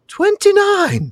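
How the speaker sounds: put-on very high, drawn-out boyish amazement; amazed squeal-pitched repeat; astonished lad. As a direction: exclaim slowly in boyish disbelief. Lad amazed at Pippin's age.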